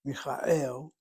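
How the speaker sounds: "Michael" in Hebrew, mee-kha-EL, stress on EL; last-syllable stress. Shown as a demonstration. Teacher shows where the emphasis falls, last-syllable stress. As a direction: put the emphasis on the final EL of "Michael". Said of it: "the emphasis is on the last syllable".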